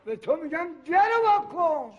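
high, insistent order; exasperated demand. Acted. Customer told the bakery is closed, exasperated high demand.

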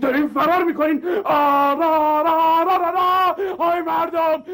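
high, loud hammed-up wail for help; mock outcry. Man hit by a car cries out to passers-by, hammed-up wail. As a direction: cry out to the whole street.

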